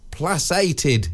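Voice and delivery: "placated" with an s sound in the middle; soft-c mispronunciation. Real, not acted. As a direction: say "placated" as plass-ay-ted. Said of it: "not placated, isn't it?".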